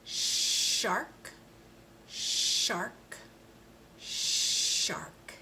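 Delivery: shark with a long sh, last one whispered; teacher repeating a word aloud; shark drilled three times. Shown as a demonstration. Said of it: "Let's try that one three times".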